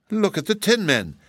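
high, merry exclamation. A narrator's reading. Polychrome amused at the battered Tin Men, merry. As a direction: point it out with amused glee, pitched high. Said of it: "with another burst of merry laughter".

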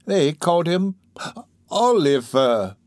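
slow, effortful dying reply; feeble. Acted. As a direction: let the name out slowly, strength failing. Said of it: "replied the woman feebly".

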